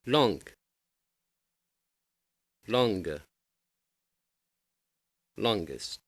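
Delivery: "Long, longer, longest" said slowly with a fall on each; teacher modelling words. Shown as a demonstration. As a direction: model each form for the class, falling. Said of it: "We shall take some examples".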